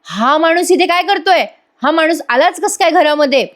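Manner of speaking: imitating an outraged father shouting; retold shout. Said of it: "Prataprao आधी ओरडतात". (रागाने ओरडल्याची नक्कल)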